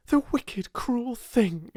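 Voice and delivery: high, then dropping into a whisper; a girl's outburst sinking to a hiss; mild outraged burst. A narrator's reading. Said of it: "she burst forth".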